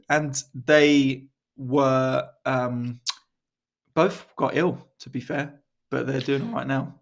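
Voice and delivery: ordinary talk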